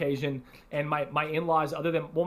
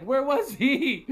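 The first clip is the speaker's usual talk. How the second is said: alarmed wife; 10 semitones higher, 4 dB louder, much slower than usual. Retold or imitated. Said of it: "my wife was like".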